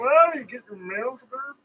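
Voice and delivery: high, slow neighbor voice; slow, high old neighbor impression; Fred down the street voice. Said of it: "This Fred down the street".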